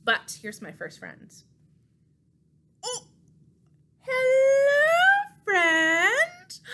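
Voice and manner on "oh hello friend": very high, loud hello; delighted puppet greeting; squealing greeting. Acted.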